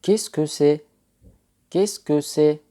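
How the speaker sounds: teacher modelling a French question. Shown as a demonstration. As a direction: call it out to the class. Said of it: "أما لتقول".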